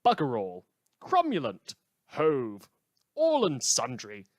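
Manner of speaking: loud, hammed-up British accent; showing off Britishisms to listeners in a mock British accent. Shown as a demonstration. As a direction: bellow each Britishism in a jokey British voice. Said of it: "try throwing a few Britishisms".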